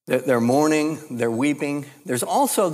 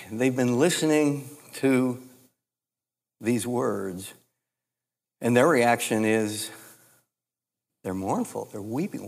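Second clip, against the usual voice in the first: ordinary talk, nothing marked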